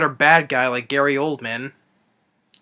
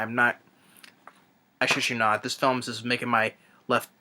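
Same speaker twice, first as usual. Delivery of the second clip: ordinary delivery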